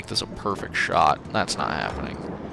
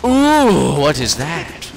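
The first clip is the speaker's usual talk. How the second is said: excited; 7 semitones higher, 5 dB louder, much slower than usual. Real, not acted. Excited finds.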